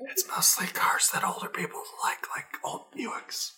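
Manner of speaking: hushed unvoiced whisper; worried whisper. Real, not acted. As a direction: whisper so the mic won't catch it. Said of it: "Why are we whispering?".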